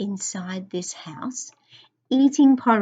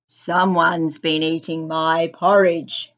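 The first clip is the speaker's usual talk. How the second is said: low, gruff bear voice; Father Bear, loud gruff voice; 5 semitones lower, 4 dB louder than usual. A narrator's reading. Father Bear finds someone has eaten his porridge, loud and gruff. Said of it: "he said in a loud, gruff voice".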